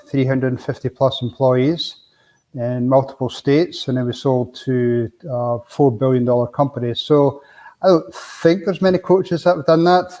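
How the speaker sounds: ordinary talk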